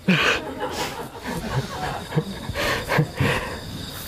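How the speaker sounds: soft unvoiced laugh, drawn out; long breathless laugh. Real, not acted. After she hangs up again, a long breathy laugh. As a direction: let a quiet wheezing laugh roll.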